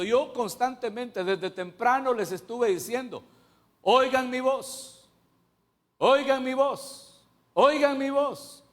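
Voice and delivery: high, slow, loud pleading as God. Acted. The Lord begging his people to hear him, loud high pleading. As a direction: stretch each oigan mi voz in a high loud plea.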